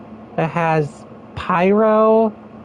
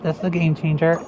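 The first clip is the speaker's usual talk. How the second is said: ordinary delivery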